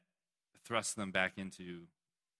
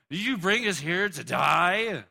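slower, higher, louder accusing wail; panicked Israelites; 4 semitones higher, 5 dB louder than usual. Retold or imitated. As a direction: accuse Moses as a frightened crowd. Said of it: "they look at Moses and they're like".

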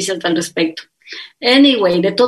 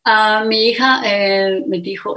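normal talking voice

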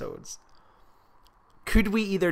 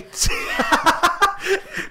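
high loud laugh; 5 dB louder than usual. Real, not acted. Co-host cracking up over the film scene, high loud laugh.

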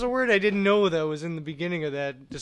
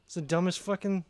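normal talking voice

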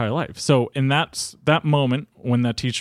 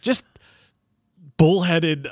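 usual speaking voice

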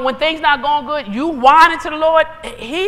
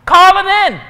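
high, loud preacher's call; emphatic call; 7 semitones higher, 8 dB louder than usual. Real, not acted. Preacher urging congregation to declare it.

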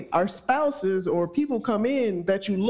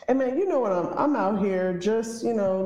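usual speaking voice

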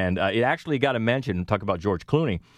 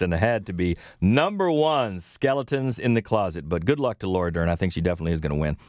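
ordinary delivery

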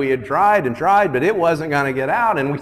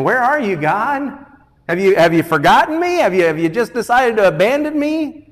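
higher, urgent cry to God; pleading question; 9 semitones higher, 5 dB louder than usual. Acted. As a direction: plead like one who feels forgotten. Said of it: "starts out with that question".

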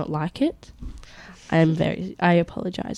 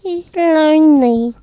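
high, loud, drawn-out lament; plaintive, slow and raised in pitch; mock pity; 7 semitones higher, 8 dB louder, slower than usual. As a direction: draw it out in exaggerated sympathy. Teasing sympathy over a lonely pet.

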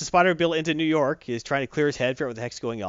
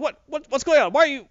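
mock-bewildered, much higher and louder; Spidey waking up in a strange world; confused outburst; 11 semitones higher, 5 dB louder than usual.

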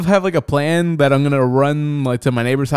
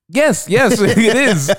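laughing through a yes, much higher; breaking into a laugh while confirming, pitched up; 8 semitones higher, 4 dB louder, much slower than usual. Retold or imitated. Telling the dispatcher yes while laughing. Retelling own answer to dispatcher.

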